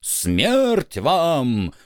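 menacing ghostly villain voice. Acted. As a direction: threaten like a storybook villain. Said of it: "изобразить нам нехорошего Бармалея".

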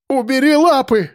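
high panicked scream; screaming in terror. A narrator's reading. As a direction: choke on the words in horror. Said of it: "кричала Лиза, захлёбываясь от ужаса".

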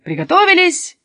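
loud high call, then firm start; bandleader's command. A narrator's reading. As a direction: give the band the order to begin. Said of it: "скомандовал Каспер".

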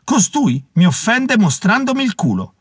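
high-pitched mock-outraged complaint; hammed-up offended outcry; exclaiming savage. A narrator's reading. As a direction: protest to your leader, pitched high. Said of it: "esclamò uno dei selvaggi".

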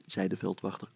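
usual speaking voice

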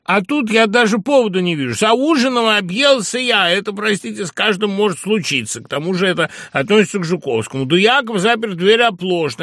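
high, loud, agitated arguing; worked-up, insistent protest; exasperated persuading. A narrator's reading. Friend argues against the duel.

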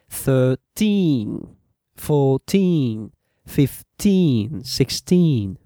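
put-on long, stressed TEEN in "thirteen, fourteen, fifteen, sixteen"; stress on final syllable. Shown as a demonstration. Teacher drills where stress falls, "thirteen, fourteen, fifteen, sixteen" with drawn-out TEEN. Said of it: "the stress falls on the".

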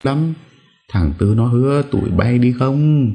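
put-on high-pitched confused old woman; bà cụ lẫn trí. (giọng bà cụ lẫn, cao)

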